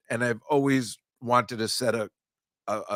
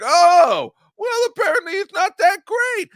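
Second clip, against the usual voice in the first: much higher, louder sarcastic mock voice; mocking sneer; 9 dB louder than usual. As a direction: sneer it in a squeaky mocking pitch. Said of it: "he's like".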